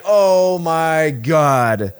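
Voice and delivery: loud, slow, high oh my God; shocked exclamation. Retold or imitated. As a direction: drag it out in horror. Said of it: "And I'm just like".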